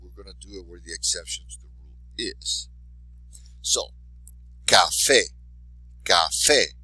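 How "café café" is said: café with a loud stressed "fé"; stress on accented syllable. Shown as a demonstration. Teacher modelling for repetition, café stressed on "fé". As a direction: punch the end of café each time. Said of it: "be sure to emphasize the accented syllable".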